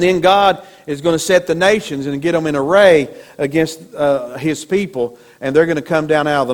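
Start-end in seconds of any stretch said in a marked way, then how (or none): none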